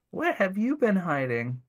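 lightly sultry come-on; sultry femme fatale. A narrator's reading. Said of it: "in a sultry voice".

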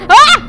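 very high, very loud scream; sudden shriek; shrill scream. Real, not acted. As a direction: shriek in real panic. Car crash scare.

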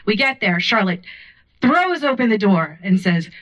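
usual speaking voice

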